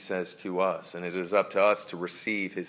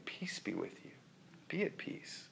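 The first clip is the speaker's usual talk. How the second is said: very soft whisper, higher and breathy; calm someone close to you; Jesus whispering be at peace; whispered reassurance; 4 semitones higher, 15 dB quieter than usual. Acted.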